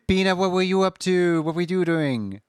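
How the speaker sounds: high, sweet streamer voice; bubbly high greeting voice; cute anime streamer. Acted.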